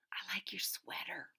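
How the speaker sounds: soft unvoiced whisper. Angela whispering to Ruby in class, hushed. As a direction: breathe it secretly across the desk. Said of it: "whispered Angela".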